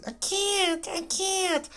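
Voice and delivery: high squeaky mock fear; hammed-up high whimper; mock scared whine. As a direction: whine it like a frightened kid. Scared of the level.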